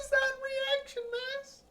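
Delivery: very high, soft disbelieving squeak; incredulous. Real, not acted.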